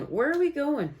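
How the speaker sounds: sing-song second asking. Real, not acted. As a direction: lift the repeat way up, playful. Repeats the question higher.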